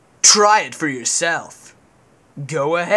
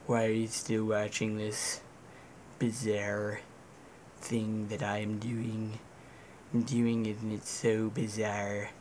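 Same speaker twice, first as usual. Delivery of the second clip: low, much softer murmur; hushed mumble; 7 semitones lower, 12 dB quieter than usual. Real, not acted. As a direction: mutter it quietly to yourself. Self-aware quiet musing at camera.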